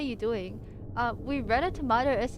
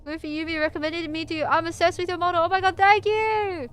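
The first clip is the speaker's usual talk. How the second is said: high excited squeal, building to louder; delighted; 7 semitones higher, 3 dB louder than usual. Real, not acted. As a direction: gush with thrilled gratitude, rising as you go. Delighted by a viewer's compliment.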